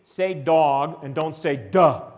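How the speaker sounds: slow, said deliberately; slow demo. Shown as a demonstration. Teacher showing kids phonological awareness, slow. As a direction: lay out dog and duh slowly. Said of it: "You say, you know".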